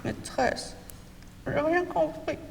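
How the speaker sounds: breathy high pupil voice, barely voiced; a timid child whispering for help; whispered child plea. Shown as a demonstration. Pupil calling the teacher again, faint whisper. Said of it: "Je le refais".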